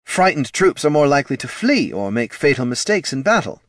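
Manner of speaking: high, loud, eager executive; keen, insistent marketing boss; head of marketing, defensive. Acted.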